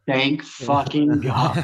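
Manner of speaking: slow, drawn-out sigh of relief; slow mock-relieved exhale of words; retold relief. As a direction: stretch it out like a weight just lifted. Relief after the bear hug.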